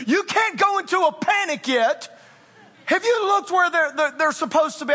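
a chiding wife's voice, played for laughs; imitating his wife. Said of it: "She, she always tells me".

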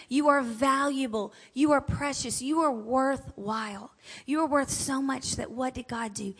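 usual speaking voice

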